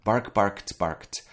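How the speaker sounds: "barked" said as barkt, one syllable; correct T endings. Shown as a demonstration. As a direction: recite "Bark, barked, barked" with crisp final T. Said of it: "it sounds like a T".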